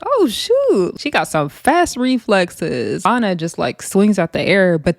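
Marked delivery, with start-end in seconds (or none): 0.1-1.0 high-pitched startled Oh, shoot with a big jump up; shocked oh shoot. Retold or imitated. Recapping a reality fight, she imitates her own shocked reaction. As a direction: squeal Oh, shoot in mock shock. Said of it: "I was like".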